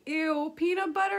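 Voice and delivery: put-on high, loud grossed-out kid; icked-out child querying PEANUT BUTTER; disgusted question. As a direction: ask it in rising disbelief. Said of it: "asked Gabby".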